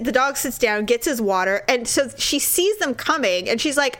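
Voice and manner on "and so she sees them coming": ordinary talk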